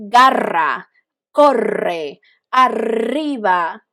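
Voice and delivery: "Garra, corre, arriba" with a long, heavily trilled R; overdone rolled R. Shown as a demonstration. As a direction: exaggerate the roll, drawing out each RR. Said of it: "we don't talk like this".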